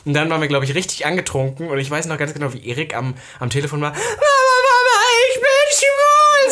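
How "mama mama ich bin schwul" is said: mimicking an ecstatic drunken phone shriek; hammed-up shrill squeal, long drawn-out and loud. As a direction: screech it very high, stretching every word out. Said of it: "So richtig laut und schrill".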